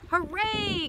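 high-pitched cheer; joyful cry. A narrator's reading. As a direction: cheer it out for little listeners. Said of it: "cries Duck".

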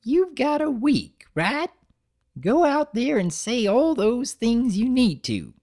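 put-on high, creaky-old grandmother voice; old granny voice.

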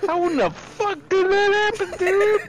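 high, loud, rapid outburst; incredulous shout. Real, not acted. Player stunned by his sudden death, incredulous shout. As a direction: shout it fast in total disbelief.

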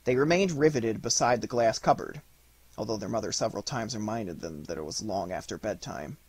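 ordinary delivery

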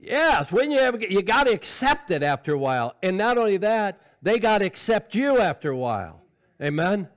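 loud, high, forceful preaching; full-force preaching. Acted. Preacher urging the church to grow up, full-force preaching. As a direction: drive it home to the congregation at full volume.

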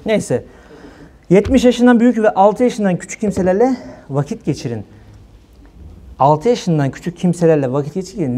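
ordinary delivery

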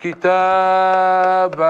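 كتابا drawn out slowly, ending on a long open "kitaaba"; slow teaching demo of كتابا with a held final a; pausal alif ending. Shown as a demonstration. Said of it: "فإنهم يبدلون التنوين ألفا ويقفون عليه بالألف".

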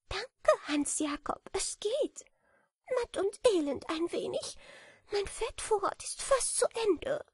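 high, thin voice, a touch worn out; weary little bat. A narrator's reading. Bat tells the beetle her fat store is gone, high and tired. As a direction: sound small and matt after winter.

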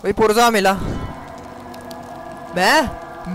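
usual speaking voice

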